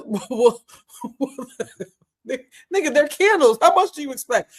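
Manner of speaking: reads the comment through high, loud giggles; can't keep a straight face reading it out; laughing while reading. Real, not acted. Reading a viewer's candle comment to chat, laughing.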